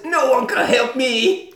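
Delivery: high, drawn-out mock despair; sad French girl. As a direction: wail it like a hammed-up tragic heroine. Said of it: "You're a beautiful French lady".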